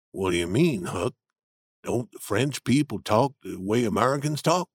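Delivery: low steady Jim arguing his point; Jim's voice. A narrator's reading. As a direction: voice the older man, grounded and sure. Jim asks.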